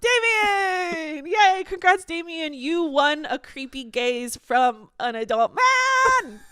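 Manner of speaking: high, loud, squealing cheer; mock cheering. Host mock-congratulating the winner, loud and high. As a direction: cheer like a game show host.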